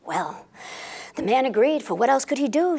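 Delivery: usual speaking voice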